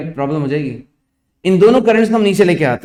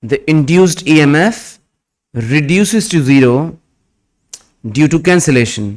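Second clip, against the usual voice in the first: slow, word by word dictation; dictating; much slower than usual. Acted. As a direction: dictate slowly for students to write. Dictation after new line instruction.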